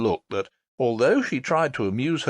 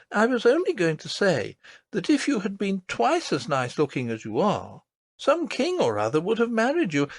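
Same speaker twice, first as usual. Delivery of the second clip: put-on high, earnest child's voice; a small girl insisting, wide-eyed; little princess voice; 8 semitones higher than usual. A narrator's reading. Princess tells her nurse about the lady, earnest.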